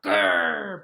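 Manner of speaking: loud voiceless dog growl; hissing rough growl; breathy growl. Acted. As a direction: growl from the throat with no voice. Bob rushes off angry.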